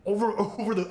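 usual speaking voice

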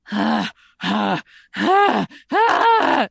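clown up the hungry panting; goofy drooling sounds. Shown as a demonstration. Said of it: "So then you go goofy".

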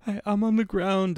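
high whimpering imitation; retold crying. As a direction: imitate a tearful caller. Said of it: "she's fucking crying".

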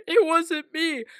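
put-on high tearful child pleading; crying kid voice. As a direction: whine it like a crying five-year-old. Kid in tears on naughty list.

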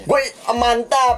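high-pitched mock Indian accent. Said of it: "lu aksennya dia dong". (aksen India dibuat-buat, nada tinggi)

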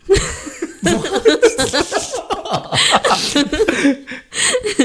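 long, high burst of laughter; loud, high-pitched cackling laugh; real laughing fit. Real, not acted. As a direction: crack up helplessly. Cracking up after a garbled phrase.

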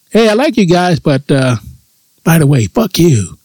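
sugary high voice turning into a jab; a lightly voiced fake-friendly aunt; mock sweet aunt. Aunt's imagined farewell to the nephews, lightly voiced, sweetly mocking. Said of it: "Kinda like a middle finger".

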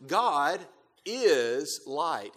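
"is" set apart after a pause and drawn out; stress on IS. Shown as a demonstration. Preacher teaching what God is, stress on "is". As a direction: lean on the word "is". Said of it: "I emphasize the word "is".

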